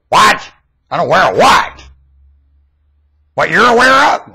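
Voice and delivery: high, loud incredulous questions; indignant disbelief. Acted. Preacher mocking the unaware world, loud incredulous questions. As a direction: throw the questions back, outraged.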